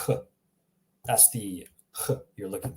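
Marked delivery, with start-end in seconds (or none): 0.1-0.2 "H" as huh from the throat; throaty H. Shown as a demonstration. Teacher repeats it for learners, throaty "H". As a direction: push "H" out as a short throat sound. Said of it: "it's coming from your throat".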